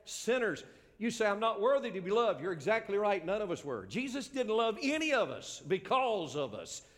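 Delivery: high, loud pulpit proclamation; full-force preaching. Real, not acted. Preacher telling sinners Jesus loves them, impassioned.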